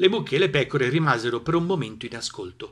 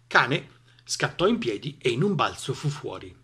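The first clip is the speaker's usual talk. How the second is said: ordinary delivery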